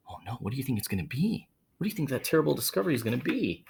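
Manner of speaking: usual speaking voice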